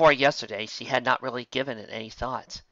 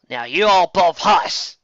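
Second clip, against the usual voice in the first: slow, loud scolding order; 7 semitones higher, 11 dB louder, slower than usual. Acted. Big sister scolding the fillies who snuck along, loudly. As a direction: bark at the naughty fillies. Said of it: "Applejack scolded".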